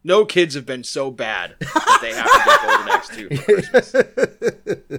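laughing through the joke. Real, not acted. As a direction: giggle through it. Mock outrage, laughing.

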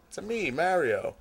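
playful South Philly Italian put-on, lifted pitch. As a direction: try a soft mock South Philly Italian accent. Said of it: "South Philly Italian accent".

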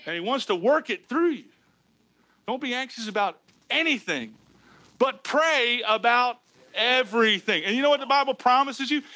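usual speaking voice

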